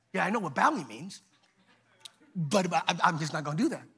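low, soft, casual refusal; unbothered refusal. Acted. Shadrach refuses the king.

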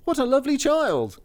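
high, delighted wonder; astonished cry. A narrator's reading. Dwarfs admiring the sleeping child, astonished cry. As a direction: exclaim high in amazement. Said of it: "cried out with astonishment".

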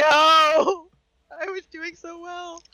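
high, slow, sobbing wail; crying. Real, not acted. Host lamenting her lost composure, high sobbing wail. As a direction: drag it out through real sobs. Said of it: "I was holding it together".

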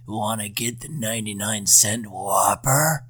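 put-on slow, creepy dirty old man voice; leering old lecher. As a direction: drawl it like a creepy old perv. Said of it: "You kinda sound like a dirty old man".

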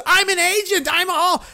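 high-pitched whiny protest. Retold or imitated. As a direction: whine it like a spoiled brat. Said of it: "he goes".

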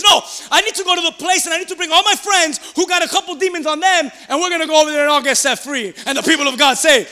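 high, shouted rallying cry; rally the congregation at full force; fired-up preaching. Real, not acted.

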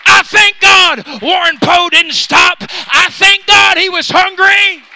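loud testimony driven to a shout; full-force preaching. Acted. Preacher thanking God for a persistent preacher, full-force preaching shout.

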